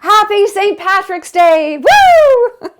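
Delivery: high, loud, slow Irish cheer with a whoop; hammed-up Irish hooray for the holiday; excited Irish accent. Greeting viewers on Saint Patrick's Day, in an excited Irish accent. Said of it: "talk with a fucking Irish accent".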